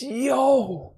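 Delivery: excited shout. Real, not acted. Excited recognition of old mixtapes.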